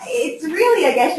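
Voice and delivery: high, giggly speech; laughing. Real, not acted. Teacher still laughing while telling the class, high. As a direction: talk through the giggle.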